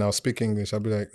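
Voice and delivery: normal talking voice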